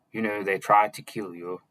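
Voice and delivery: plain reading voice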